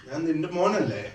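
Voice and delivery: cheeky comeback. Retold or imitated. Brother's cheeky comeback to Dad.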